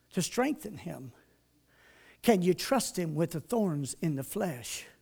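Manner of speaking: ordinary delivery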